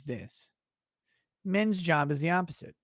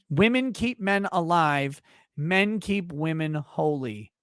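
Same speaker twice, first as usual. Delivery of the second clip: normal talking voice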